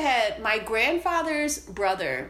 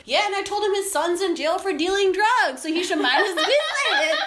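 laughing through a retold comeback; 8 semitones higher, 4 dB louder than usual. Retelling her own cheeky comeback.

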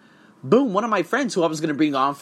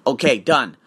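ordinary delivery

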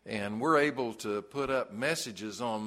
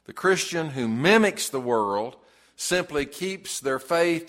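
louder, rising pulpit preaching; preaching at full force; 3 semitones higher, 6 dB louder than usual. Acted. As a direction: drive the point home to the congregation. Sermon building to full force.

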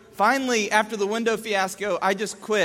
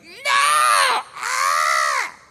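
very high, loud mock scream; hammed-up tantrum shriek; toddler yelling; 7 dB louder than usual. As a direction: imitate a child yelling a refusal. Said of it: "keeps yelling".

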